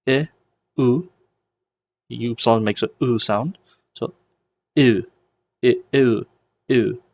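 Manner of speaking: "e" as eh and "u" as oo, then run together; teacher's demo of merging "e" and "u"; blending vowels. Shown as a demonstration. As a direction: sound out "e, u" toward a single you sound. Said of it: "when you blend that".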